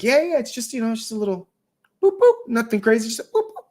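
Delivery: put-on high, sing-song reassurance to a little girl; reassuring dad. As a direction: play it down sweetly, boop boop. Dad soothing his worried daughter.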